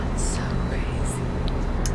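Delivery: hushed whisper. Real, not acted. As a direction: breathe it out in awe. Ghost hunt, whispered awe.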